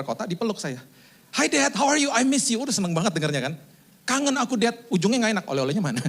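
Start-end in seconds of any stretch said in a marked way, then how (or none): 1.4-2.6 put-on high-pitched eager child's greeting; little child. Young child hugging returning father, high excited imitation. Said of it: "Sama seperti anak kecil". 4.0-4.8 put-on high, wheedling child voice; little child. Small child angling for souvenirs from father, high childlike imitation. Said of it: "Sama seperti anak kecil".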